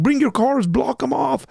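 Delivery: high, imitating a woman shouting at neighbors; mock yell. Woman blocking ICE agents calling to neighbors, high mock yell. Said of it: "yelling and cussing them out".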